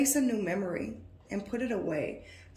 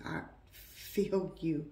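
slow, softer repeat of I feel you; tender, slow and soft; 3 semitones lower, 4 dB quieter, slower than usual. Real, not acted. Reader comforting the viewer, tender and slow. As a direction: say it gently, like a hand on the shoulder. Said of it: "tapped into your energy".

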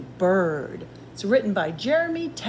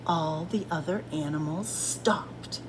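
softer, lower storytelling hush; hushed narration; 4 semitones lower, 6 dB quieter than usual. A narrator's reading. Reader tells how animals copy Bird, hushed. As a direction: drop to a hush.

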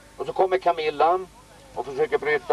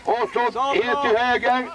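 fast, high, excited match commentary; live play-by-play; 8 semitones higher than usual. Acted. Commentator calling the game to listeners, excited play-by-play. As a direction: call every pass like it's a cup final.

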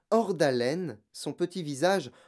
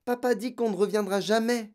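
put-on high, dismayed child's voice; upset girl appealing; distressed; 8 semitones higher than usual. A narrator's reading. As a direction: report it as dreadful news. Girl continues her distressed cry.

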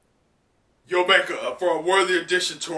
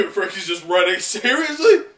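laughing; 6 semitones higher, 9 dB louder than usual. Real, not acted. Laughing reaction while reading manga.

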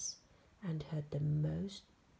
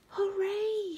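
high, loud cheer; excited shout; 13 semitones higher, 6 dB louder than usual. A narrator's reading. George cheers at finding a monster, excited high shout. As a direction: shout hooray in a high, boyish voice. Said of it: "shouted George".

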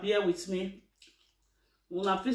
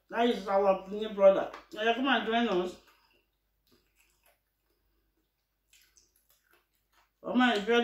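eating from bowl while speaking; chewing while talking. Real, not acted. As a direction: mouth partly full of food. Seen 1: Holds food bowl, hand to mouth before; mouth seems to chew.

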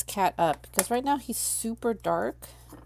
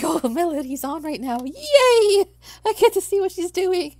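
high, loud mock-fangirl excitement; excited fan; 7 semitones higher, 9 dB louder than usual. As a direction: squeal with playful glee, laughing on the cheer. Acting out a delighted fan stalker.

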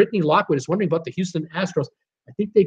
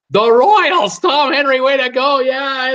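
high, loud congratulations; a host celebrating a correct answer; excited cheer; 8 semitones higher, 5 dB louder than usual. Real, not acted. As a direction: cheer the winner on.

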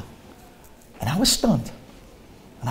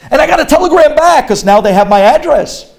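ordinary talk, nothing marked

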